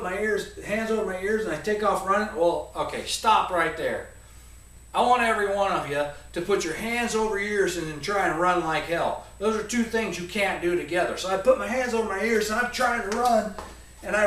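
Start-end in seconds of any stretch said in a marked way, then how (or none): none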